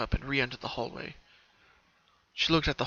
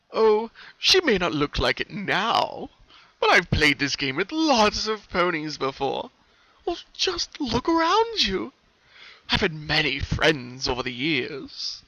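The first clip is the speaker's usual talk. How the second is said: put-on slow, higher, sinister sing-song boy voice; creepy colt; 5 semitones higher, 4 dB louder, slower than usual. The colt bragging to the filly, slow and creepy. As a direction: savor each word like a predator.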